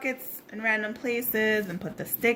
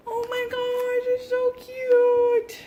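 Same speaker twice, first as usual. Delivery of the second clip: much higher, louder and slow; slow squeal; 9 dB louder, slower than usual. Real, not acted. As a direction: stretch it out in a delighted squeal. Squealing over craft.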